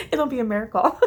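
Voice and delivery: laughing through the words; a real laugh breaking into the line; giggling at her own joke. Real, not acted. Joking about a short video.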